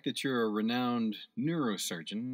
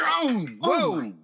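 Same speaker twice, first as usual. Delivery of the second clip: startled shout; 4 semitones higher, 10 dB louder than usual. Acted. Hand crushes the wine bottle.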